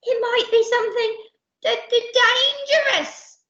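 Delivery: high, stammering fright; trembling. Acted. Percy fearing the chest, high nervous stammer. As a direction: stutter it in a high, fearful voice. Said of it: "but Captain trembled".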